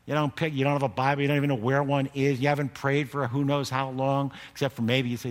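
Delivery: ordinary talk, nothing marked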